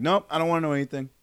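emphatic mock refusal; imitated firm refusal. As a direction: imitate a friend shutting it down. Quotes friend refusing to see trailers.

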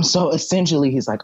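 normal talking voice

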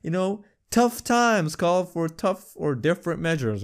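high-pitched breezy ad imitation; mock casual. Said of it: "trying to make it look normal".

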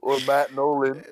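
laughing out the joke name. Real, not acted. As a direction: giggle through the words.

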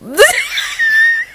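high squealing laugh; helpless laugh. Real, not acted. Speaker laughing at her slip on air, helpless squeal. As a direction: burst into a mortified squeal of laughter.

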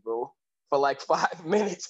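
usual speaking voice

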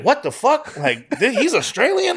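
high, incredulous rising question; retold amazed double-take; mock astonishment. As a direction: shoot the pitch up in disbelief. Retelling his astonishment at the interview.

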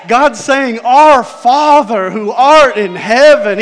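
loud, slow preaching cry. Acted. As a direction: preach it at full force. Preaching at full force.

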